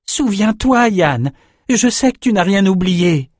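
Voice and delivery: high-pitched, insistent appeal; urgent plea. A narrator's reading. Swordsman appeals to his old friend mid-fight, urgent plea. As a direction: implore him to remember, starting very high.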